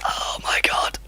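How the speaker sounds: breathy whispered oh my god. Real, not acted. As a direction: let it slip under your breath, voiceless. Caster despairs under his breath.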